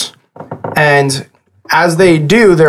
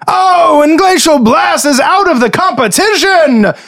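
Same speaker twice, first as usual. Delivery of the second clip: booming high announcer calling the elimination; excited arena commentator; 11 semitones higher than usual. Acted. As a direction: shout it to the crowd like a sports announcer.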